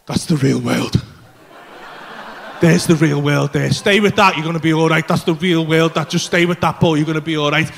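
low, earnest self-talk; low urgent self-reassurance; self-reassuring. Retold or imitated. Calming himself while tripping.

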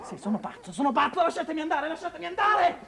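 acuto e sempre più alto, fino a una risata folle; grido isterico. Acted. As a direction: lascia esplodere la follia. Figlio urla in crisi. (grido isterico con risata)

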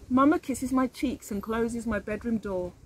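usual speaking voice

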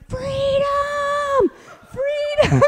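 put-on high, ringing child's shout; shouting freedom. Mother imitates her son shouting at the TV. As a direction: cry it out like a rallying call. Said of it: "shouting it at the television".

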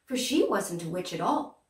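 plain reading voice

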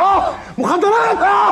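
high, loud panicked screams; screaming in pain. Acted. Husband screaming while his brother-in-law hits him. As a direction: shriek as the blows land. Beaten man screams during fight.

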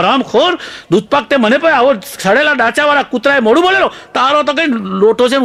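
high-pitched outraged scolding; hammed-up fit of rage, pitched right up; furious teacher. Teacher cursing the pupil over the dog-licked sweet, furious. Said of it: "અને સાહેબે ગુસ્સામાં ને ગુસ્સામાં".

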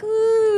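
high, loud, drawn-out swooning "ooh". Retold or imitated. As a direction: mimic being charmed by an accent. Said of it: "automatically you're like".

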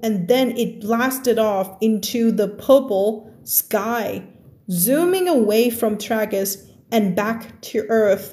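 slow, drawn-out English story read. Acted. Teacher reading a story to students, slow and drawn out. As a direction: read each word out slowly for learners.

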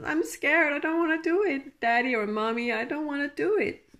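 put-on high, quick whiny child voice pleading; scared little child. Reader voicing the querent's fear as a scared child, high whining plea. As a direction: whimper it like a frightened kid begging a parent. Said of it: "like a little child".

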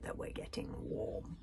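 low, slow, very soft; hushed suspense. A narrator's reading. Hiccup telling friends they are close, hushed. Hiccup answers panic as swords drawn.

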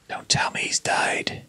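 gasped, hushed dread; fearful whisper. Acted. A child gasping in fear at the granny's sad story. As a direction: whisper as if bracing for bad news.

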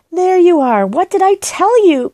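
bursting with glee; crying out. A narrator's reading. Girl telling the bear the housekeeper likes him, triumphant. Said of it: "cried Judy".

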